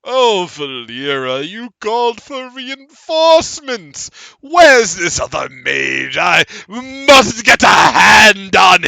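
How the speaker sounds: hammed-up creepy roar; excited monster shout. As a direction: shout out eager for prey. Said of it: "And you hear".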